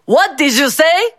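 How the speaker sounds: angry boss catching the insult; booming. A narrator's reading. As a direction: boom it from behind them. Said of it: "They heard the booming voice behind them".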